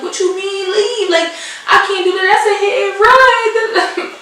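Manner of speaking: high, rising disbelief with a laugh; can't believe what she's hearing; shocked that her supervisor says to flee; incredulous. Retold or imitated. Disbelief at boss telling her leave.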